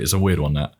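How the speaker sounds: usual speaking voice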